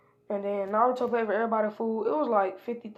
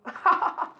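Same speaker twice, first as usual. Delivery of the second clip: high breathy burst of mocking laughter; short loud mocking cackle; 9 semitones higher, 5 dB louder than usual. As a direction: laugh in his face. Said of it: "And he was just laughing".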